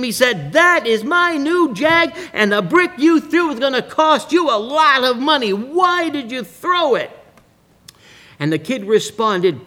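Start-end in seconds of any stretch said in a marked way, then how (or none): 0.5-7.2 high, heated scolding; building anger. Retold or imitated. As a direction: let the steam build as you scold. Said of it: "building up a head of steam".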